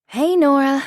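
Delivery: high, loud, eager hello; bright rushed hey to the shopkeeper; breathless greeting. Acted. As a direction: call out on arrival, a bit winded. Breathless mother bursts in.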